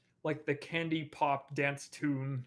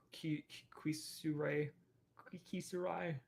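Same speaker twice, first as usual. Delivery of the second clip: whispering; 7 dB quieter than usual. Real, not acted. Whispered goodbye to a viewer.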